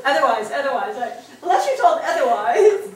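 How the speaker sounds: loud, talking through real laughter; laughing. Real, not acted. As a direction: keep talking while you laugh. Still laughing at book's wording.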